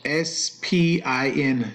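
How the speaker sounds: letter by letter, S P I N; spelling words out. Shown as a demonstration. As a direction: say S, P, I, N as separate letters. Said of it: "Why are you spelling words out?".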